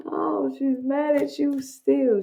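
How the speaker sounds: normal talking voice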